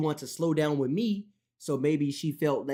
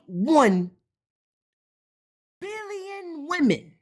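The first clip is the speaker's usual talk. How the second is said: loud incredulous rant; 9 semitones higher, 4 dB louder than usual. Real, not acted. Ranting at male viewers about eight billion women, loud and high. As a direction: yell it like you cannot believe it.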